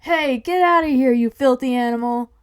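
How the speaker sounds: loud, high shout to chase someone off; full-voiced, outraged holler; angry yell. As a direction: yell like an irate shop owner. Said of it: "the coffee shop owner yells".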